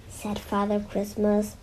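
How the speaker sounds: usual speaking voice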